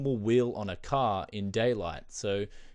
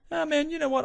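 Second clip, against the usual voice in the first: sharply higher, louder opening sigh; mock breezy exclamation; 4 dB louder than usual. You telling the jealous friend your choice, pitched way up. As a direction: toss it off with a big upward swoop. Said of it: "you say to him".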